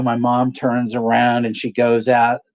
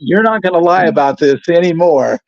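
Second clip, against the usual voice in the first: scolding mom; 5 semitones higher, 4 dB louder than usual. Retold or imitated. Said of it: "I said".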